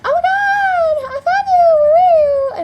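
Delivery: very high, loud drawn-out shriek; screechy, over-the-top reunion yell; excited reunion squeal. Retold or imitated. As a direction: squeal it like finding a lost friend. Acts loud reunion with her friend.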